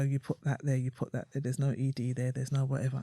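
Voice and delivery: low, soft, lightly voiced husband; correcting husband. Voicing husband correcting her text.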